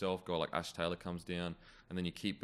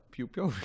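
high, playful pew pew gun noise; toy-gun zap mimicked from the film; movie gun sound; 8 semitones higher than usual. Host quoting a favourite film bit, playful high pew pew. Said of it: "I love the bit where he's like".

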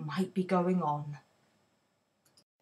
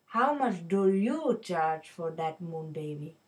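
put-on Indian accent; 4 semitones lower than usual. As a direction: ask it gently like a loving wife, Indian accent. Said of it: "I'm going to have to don an Indian accent".

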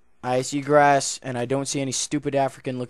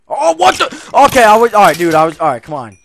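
very high, loud shocked shout fading down; jolted by a jump scare, shrieking; startled yell; 9 semitones higher, 19 dB louder, faster than usual. Real, not acted. Gamer startled by sudden attack, loud high yell.

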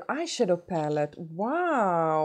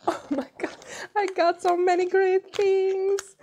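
overjoyed squeal; 4 dB louder than usual. Real, not acted. Friend thrilled by so many great gifts, high squeal.

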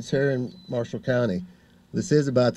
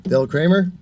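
louder and higher to call the next speaker; 6 semitones higher, 6 dB louder than usual. Real, not acted. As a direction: call the name across the crowd.

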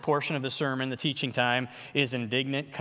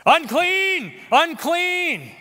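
high, loud cry of "Unclean!"; desperate warning shout; leper's cry; 13 semitones higher, 7 dB louder, much slower than usual. Acted. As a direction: shout it out like an outcast warning people off. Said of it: "is what I would have to yell out".

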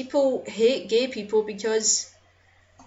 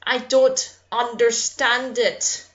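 ordinary talk, nothing marked

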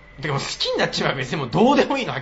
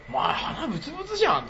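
playful teasing; 11 semitones higher, 4 dB quieter than usual. Retold or imitated. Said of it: "とかさ".

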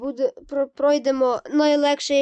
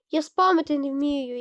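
ordinary talk, nothing marked

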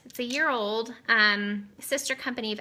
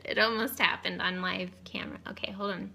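whisper; 5 dB quieter than usual. Real, not acted. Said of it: "whisper after laughing".

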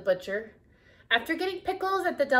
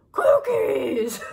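loud excited monster cry; monster shout; 3 semitones higher, 11 dB louder than usual. Acted. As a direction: shout it like he found treasure. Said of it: "he shouted".

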